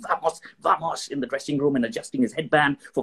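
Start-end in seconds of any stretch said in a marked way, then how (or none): 0.1-1.1 slow, higher psyched-up "vamos, vamos" in a voice unlike his own; Nadal pumping himself up. Retold or imitated. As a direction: drag out "vamos, vamos" like a tennis player firing himself up. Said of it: "if you think of Nadal doing".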